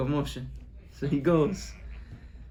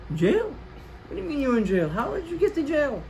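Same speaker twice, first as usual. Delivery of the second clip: shocked; 8 semitones higher than usual. Acted. Father shocked by jail news.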